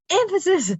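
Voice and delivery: EMPHASIS blurted high and loud; mock emphasis. Sons teasing mom to repeat it, "emphasis" exaggerated high and loud. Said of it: "say it again for emphasis".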